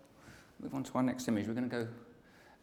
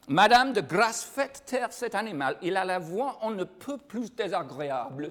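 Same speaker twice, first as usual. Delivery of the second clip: louder, higher French reading, "Madame, de grâce" punched; French with full accent, louder and higher; clearer French; 5 semitones higher, 4 dB louder than usual. Shown as a demonstration. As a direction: declaim the French line clearly for the class. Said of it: "I'll say it again for you because you probably didn't understand it the way I was saying it".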